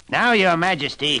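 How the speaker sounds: booming triumph; thundering little man. Acted. The little man demands his prize from the queen, loud. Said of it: "he thundered".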